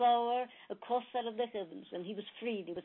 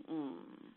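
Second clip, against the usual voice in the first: groan; 5 semitones lower, 8 dB quieter than usual. A narrator's reading. Dying buffalo groans.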